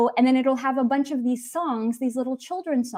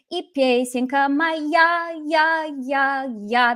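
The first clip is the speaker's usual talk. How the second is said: моя drawn out, with я echoed in beats; singsong imitation hammering the stressed я in моя; echoed final stress; 2 semitones higher, slower than usual. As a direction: stretch the end of моя and repeat я rhythmically. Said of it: "the way he says it".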